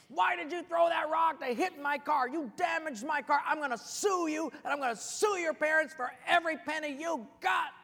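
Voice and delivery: loud, high, angry tirade; furious yelling. Acted. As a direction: yell threats at the child who wronged you. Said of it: "starts yelling at this kid".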